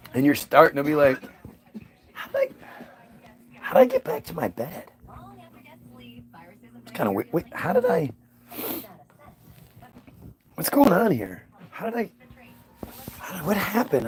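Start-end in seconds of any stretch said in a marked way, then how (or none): 2.1-14.1 bewildered high muddled voice; groggy and lost, piecing it together; confused. Pretend. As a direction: hungover confusion, higher and softer. Waking up confused, retold.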